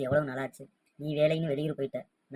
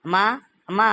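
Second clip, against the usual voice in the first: calling out; 5 semitones higher, 10 dB louder than usual. Acted. Son calls mother from outside.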